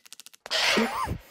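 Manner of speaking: sharp high yelp; startled cough-yelp. Real, not acted. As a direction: jump at the monster. Panic as monster appears.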